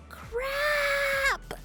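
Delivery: high, long-drawn wail; frustrated cursing. Acted. New tenant who overslept cursing themselves, dismayed wail. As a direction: stretch the curse out high in dismay. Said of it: "you silently curse yourself".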